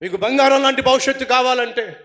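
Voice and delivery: preaching with sudden loud bursts; forceful preaching. Acted. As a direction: exhort the congregation to give up sin. Preacher exhorts the congregation. (బలమైన ప్రసంగ శైలి)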